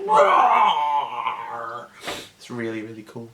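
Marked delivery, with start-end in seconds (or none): 0.1-1.9 playful roaring monster. Retold or imitated. Stitch wrecking the toy city, mock roar. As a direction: roar like a little destructive beast. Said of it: "he's just like".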